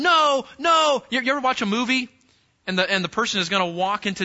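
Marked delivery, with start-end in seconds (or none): 0.0-1.0 raised, loud cry of warning; alarmed onlooker. Acted. Watcher at the lattice pleading with him, alarmed shout. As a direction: call out higher and louder to stop him. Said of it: "you're sitting there going".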